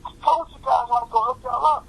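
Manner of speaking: comic high-voiced impression; high mock voice. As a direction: crow it up high. Wanye voiced on call.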